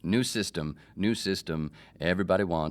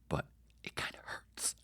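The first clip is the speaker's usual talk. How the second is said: soft, whispered confession; hushed mock-wounded aside; hurt whisper; 11 dB quieter than usual. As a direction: drop to a whisper as you admit it.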